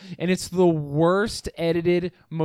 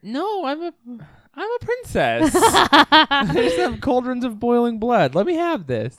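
laughing protest; 9 semitones higher, 5 dB louder than usual. Real, not acted.

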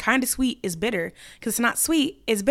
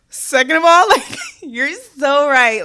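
laughing agreement; 4 semitones higher, 6 dB louder, slower than usual. Real, not acted.

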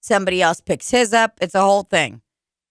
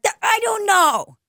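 Buffalo guy impression; 11 semitones higher than usual. Friend from Buffalo, imitated on a podcast, lightly voiced. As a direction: mimic a Buffalo friend's shrugging I don't know. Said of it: "the way he talks about anything".

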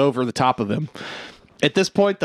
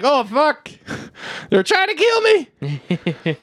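mock panic; 11 semitones higher, slower than usual. Plane tries to run him over.

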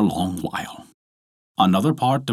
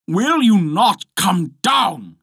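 high, drawn-out demand; impatient cry; 11 semitones higher, 4 dB louder, slower than usual. A narrator's reading. Husband demanding his wife come down, crying out. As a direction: call it out slowly in a raised pitch. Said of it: "cried Bluebeard".

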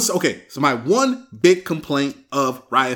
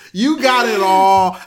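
louder and slow, hammed-up envy; mock complaint; 4 semitones higher, 8 dB louder, slower than usual. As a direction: lean into the mock grievance. Mock rant at good-looking dudes.

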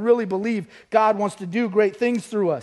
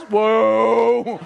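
long, loud mock whoa; amazed reaction; 6 dB louder than usual. Disciples watching the ear healed, hammed-up amazement. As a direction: draw out an awestruck whoa at full voice.

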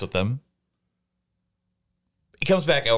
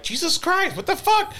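much higher, exasperated outburst; shocked disbelief. Retold or imitated. Retelling the cashier's news to friends, high incredulous outburst. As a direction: throw up your hands in disbelief. Hearing of overdoses on her shift.

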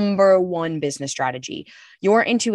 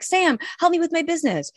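pleading ask; 9 semitones higher than usual. Retold or imitated. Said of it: "I went to Sam and was like".

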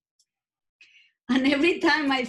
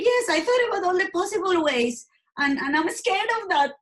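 raised pitch, a touch of retold fear; retold fearful confession; 7 semitones higher than usual. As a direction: relive telling mom, voice climbing higher. Confessing suicidal thoughts to mom.

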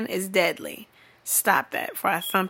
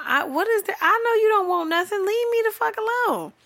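very high, squealed exasperation; fast high-pitched plea to be left alone; exasperated outburst; 12 semitones higher than usual. Acted. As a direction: squeal it, utterly fed up. Acted exasperated reply to DM sender.

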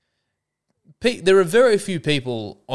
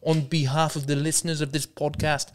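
higher-pitched attempt at a South African accent; 5 semitones higher than usual. Shown as a demonstration. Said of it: "working on my South African accent".

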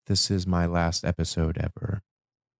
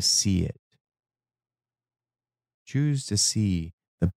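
poem recitation. Acted. Recites a spoken word poem.

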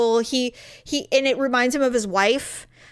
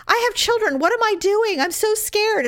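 higher, whiny imitation of a scared celebrity; mocking the celebrity's scared whining, higher; 8 semitones higher than usual. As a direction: mimic her frightened whimper, pitched up. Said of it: "she kept saying".

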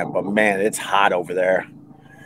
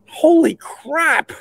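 exasperated; much slower than usual. Retold or imitated. Exasperated story punchline.

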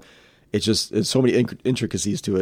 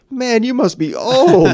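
put-on much higher, louder, slow teasing kid; 13 semitones higher, 7 dB louder, slower than usual. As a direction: draw it out like a cheeky young gamer. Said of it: "the kid goes".

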